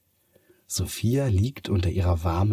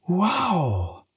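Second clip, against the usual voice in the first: much higher, drawn-out wow; amazed; 12 semitones higher than usual. A narrator's reading. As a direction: gasp in wonder. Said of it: "staunt sie".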